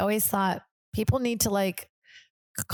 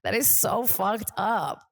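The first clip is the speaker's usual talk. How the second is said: disgusted; 4 semitones higher, slower than usual. Real, not acted. Outraged about manager.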